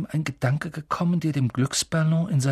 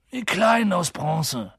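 slow, higher, falling drowsy voice; Hagrid, sleepy; 5 semitones higher, 3 dB louder than usual. Acted. Drowsy giant explaining the coins to the boy, sleepy. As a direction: explain it half asleep. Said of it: "sagte Hagrid schläfrig".

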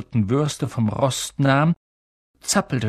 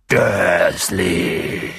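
low, drawn-out giant's bellow; booming; 6 semitones lower, 3 dB louder than usual. A narrator's reading. Giant about to explode calls the uncle, booming. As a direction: boom the name, deep and long. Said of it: "dröhnte er".

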